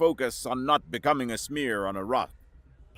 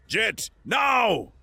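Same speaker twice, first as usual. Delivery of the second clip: shout; 10 semitones higher, 4 dB louder than usual. A narrator's reading. Signal shouted in space.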